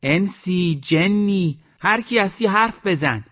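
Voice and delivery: high, drawn-out calling out; shouting. A narrator's reading. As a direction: call down to whoever is hiding. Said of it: "ننه خانوم داد زد".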